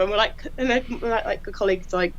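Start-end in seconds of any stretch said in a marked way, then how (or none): none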